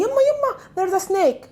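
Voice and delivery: high-pitched warning cry, lightly acted; mock alarm. Shown as a demonstration. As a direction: call out a pretend snake warning. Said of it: "I'm afraid of something".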